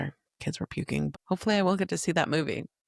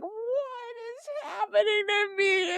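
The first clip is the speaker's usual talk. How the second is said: high, stretched-out cry of distress; imitated tearful, drawn-out whine; scared toddler wail; slower than usual. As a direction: wail it like a frightened little one. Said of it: "be so scared 'cause she's like".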